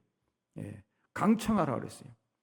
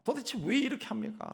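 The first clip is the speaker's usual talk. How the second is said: high-pitched indignant demand; 8 semitones higher than usual. Acted. Believer takes God to task over events, demanding. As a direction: argue back in an acted, raised-pitch complaint. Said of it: "라고 따지는 거예요".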